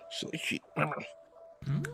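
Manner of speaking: hushed, whispered silly sheep; real soft whisper; whispered aside. Real, not acted. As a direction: murmur to the pet softly. Murmured aside to pet.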